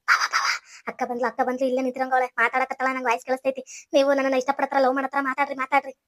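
low, soft conspiratorial undertone; hushed secret prompting. Acted. Sister-in-law secretly warns him his wife is listening, hushed. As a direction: keep your voice down, low and secretive.

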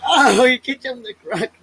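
high, loud outcry; loud startled reaction; excited cry. Real, not acted. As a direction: wince out loud at the kick. Reacting loudly to a kick on screen.

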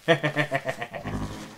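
low, quiet chuckle to yourself; gloating chuckle. Real, not acted. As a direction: chuckle softly over a new weapon. Gloats after getting Ionic Vortex.

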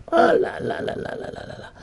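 airy high "ah là là là" trailing off; teacher sighing over how hard it was; breathy sigh exclamation. Real, not acted. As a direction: sigh it out, overwhelmed. Sighing exclamation about hardship.

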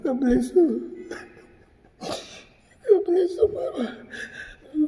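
slow, tearful blessing breaking down; real crying, voice cracking. Real, not acted. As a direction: sob the words out, barely holding it. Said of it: "I'm sorry for breaking down".

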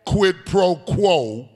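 whooping sermon cadence, half sung over the organ; preaching. Acted. Preacher to the congregation, sung whooping cadence. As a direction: preach it, rising to a sung holler. Preaching at full force with music.

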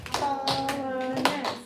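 Colonist split into slow, separate syllables; clapped syllables. Shown as a demonstration. Teacher clapping Colonist for second graders, slow and syllable by syllable. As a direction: clap out each syllable of Colonist slowly. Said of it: "Let's clap the syllables in colonist".

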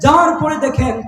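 high ringing sermon narration; full-force preaching. Acted. Preacher telling of the ride to Kab's house, high impassioned narration.

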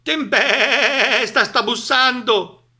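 high, loud, quavering bleat, drawn out; bleating sheep's cry. A narrator's reading. Frightened sheep hear knocking, crying out in bleats. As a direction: wail it like sheep with a long wobbling bleat. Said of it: "gridarono le pecore".

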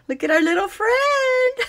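cutesy voice. Real, not acted. Cooing over a cute figure.

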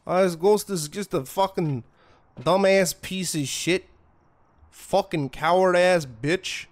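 trash-talk the ghost in a mock Wisconsin accent; ghost hunter accent. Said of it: "my ghost hunter accent is kinda like North Dakotan or Wisconsin".

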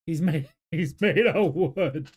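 laughing while repeating the line. Real, not acted. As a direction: chuckle through the words. Reacts laughing to show line.

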